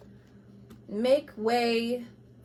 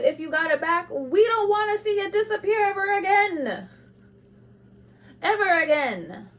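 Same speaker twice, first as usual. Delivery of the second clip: higher, crying plea; tearful plea; 10 semitones higher, 5 dB louder than usual. Real, not acted. Tarot reader begging Libra, voice breaking into tears. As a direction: voice breaking as she begs. Pleading with Libra to keep light.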